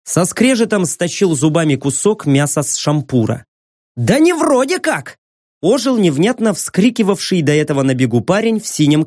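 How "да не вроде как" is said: excited. Acted. Excited young man blurts.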